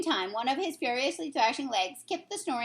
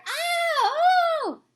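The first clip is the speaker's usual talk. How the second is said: high pained shout; tortured giant yelling in his sleep; 8 dB louder than usual. Acted. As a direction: howl out in torment. Giant thrashing in nightmare.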